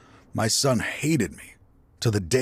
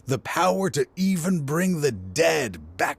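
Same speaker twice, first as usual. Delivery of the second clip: rising fervor; 6 semitones higher than usual. Acted. Villain reveals his goal.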